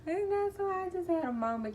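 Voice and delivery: high voice squeezed by real laughter; tickled. Real, not acted. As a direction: squeal it out, recovering from a laugh. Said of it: "it's still tickling me".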